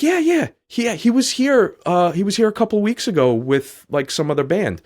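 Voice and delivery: put-on high, bright-eyed girl's question. Girl voiced in a story.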